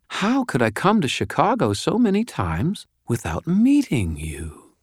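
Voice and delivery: high, flirtatious woman's voice, falling away at the end; sultry cousin. Acted. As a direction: flirt with the wizard, playful and high.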